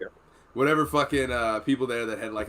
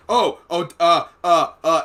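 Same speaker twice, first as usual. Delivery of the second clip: loud, raised, stuttering hesitation; imitated nervous stammer; 4 semitones higher, 9 dB louder than usual. Corpsman walks up to the wound, mock nervous stammering. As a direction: mimic a flustered, stuck corpsman. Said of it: "he might have been nervous".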